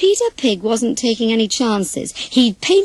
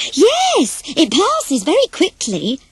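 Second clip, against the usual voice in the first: eager agreement; 6 semitones higher, slower than usual. Acted. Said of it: "agreed David".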